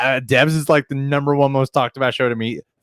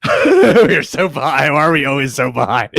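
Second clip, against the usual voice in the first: laughing; 2 semitones higher, 4 dB louder than usual. Real, not acted. Laughing at lag.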